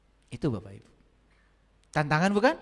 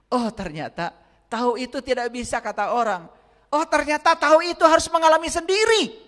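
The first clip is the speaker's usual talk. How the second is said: builds to a high, loud cry; climactic preaching; 12 semitones higher, 10 dB louder than usual. Acted. Preacher climaxing on the lesson, loud and high. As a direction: rise to a shouting peak on sendiri.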